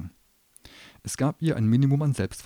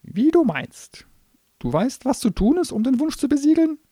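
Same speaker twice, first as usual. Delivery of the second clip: feigned casual; 8 dB louder than usual. Acted. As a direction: act offhand while scheming. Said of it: "so beiläufig wie möglich".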